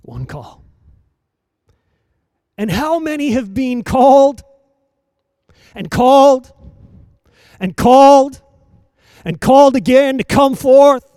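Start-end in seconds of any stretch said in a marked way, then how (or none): 2.6-11.0 higher, louder, hammering each called; building preaching. Acted. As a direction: drive the repetition home to the crowd.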